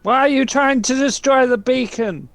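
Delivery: high, loud interrogating shout; angry demand. Acted. Player confronts the captive about the beacon, angry demand.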